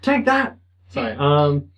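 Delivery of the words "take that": high shouted lawyer callout; Phoenix Wright. Pretend. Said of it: "Phoenix Wright voice".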